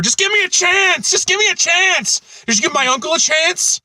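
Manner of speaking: high, pleading desperate plea; pleading burglar. Retold or imitated. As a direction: beg for your life, then turn it around. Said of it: "a lot of quoting lines".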